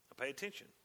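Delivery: ordinary talk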